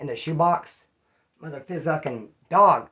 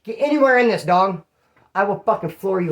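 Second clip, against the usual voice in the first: loud, raised warning; angry threat; 3 semitones higher, 8 dB louder than usual. Retold or imitated. Warning his cousin to back off, loud angry threat. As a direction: bark the threat at him.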